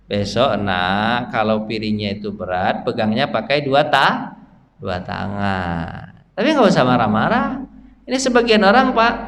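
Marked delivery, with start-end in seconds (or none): 0.1-6.3 low, soft, slow fatherly advice; nasihat lembut. Acted. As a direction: gently counsel a child, taking your time. Menasihati anak dengan lembut. (menasihati anak dengan lembut dan pelan)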